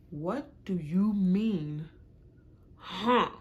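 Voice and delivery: slow, drawn-out demand, rising loud on huh; indignant retort. Retold or imitated. Girlfriend confronting her man over his huh. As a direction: drag out the question then snap huh.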